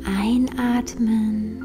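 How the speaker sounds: slow, soft meditation voice; guided meditation. Acted. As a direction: guide them gently into calm, slowly.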